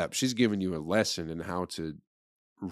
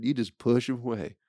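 mock instructor; 6 semitones higher than usual. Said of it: "They go".

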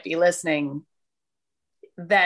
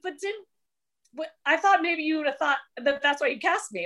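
higher, puzzled self-quote; high confused voice; 7 semitones higher than usual. Retold or imitated. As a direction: sound baffled and unsure. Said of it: "I was like".

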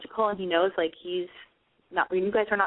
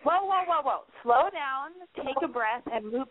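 normal talking voice

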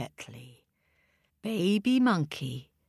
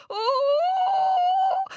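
very high frightened ooh; scared monkey squeal; 4 dB louder than usual. Acted. Monkey facing the dogs, high scared squeal.

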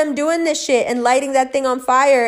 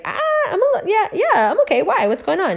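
pitched way up, caught off guard; startled reply; 11 semitones higher than usual. Retold or imitated. Answering her mother's worried call, high and flustered.

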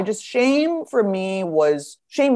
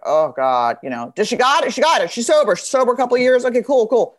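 higher, louder mock parents' fretting; hammed-up hopeful then dismayed parents; worried parents; 7 semitones higher, 4 dB louder than usual. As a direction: play the anxious parents cheering then groaning. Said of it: "My poor parents are just like".

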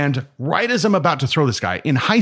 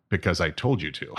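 stern master; 6 semitones lower, 7 dB quieter than usual. Acted. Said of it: "asking my master".

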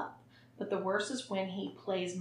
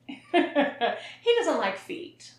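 laughing; 5 semitones higher, 5 dB louder, much slower than usual. Real, not acted. Laughing about the sub.

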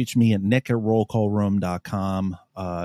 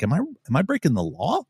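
higher-pitched worried question; retold anxious self-questioning; self-doubting; 6 semitones higher than usual. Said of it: "I doubted myself".

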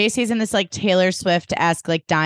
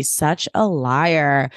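slow disapproval; 3 semitones lower, much slower than usual. Real, not acted. Recapping Obi's lie.

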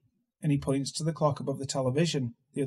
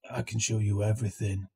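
a lower, sly inmate voice; low and knowing, like a dealer; Coffee Man voice; 5 semitones lower than usual. A narrator's reading. Coffee Man tempts the narrator, low and sly.

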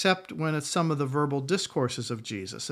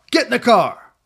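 "Get in the car." shouted higher and louder; angry shout; 6 semitones higher, 10 dB louder than usual. Shown as a demonstration. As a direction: snap it out like a fed-up parent. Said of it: "say the same words in a lot of different ways".

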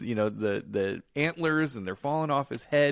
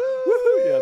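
excited whoop; 7 dB louder than usual. Retold or imitated. Mimics cheering riders in movie.

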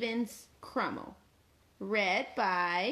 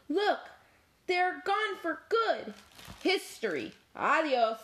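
Cat; 8 semitones higher than usual. Acted. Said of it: "said Cat".

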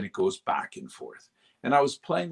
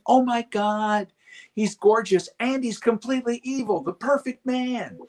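swooning women; 13 semitones higher, 5 dB louder than usual. Retold or imitated. Mimicking women swooning over the character. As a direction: gush like a smitten fan. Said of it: "all the women were, like, going".

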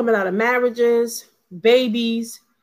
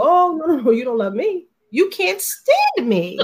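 retold breakup; 4 semitones higher than usual. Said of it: "I was like".

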